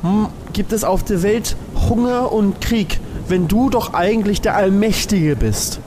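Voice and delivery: normal talking voice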